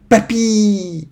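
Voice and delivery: high-pitched falling call for grandpa; child's cry. A narrator's reading. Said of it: "Azura cria". (cri d'enfant aigu)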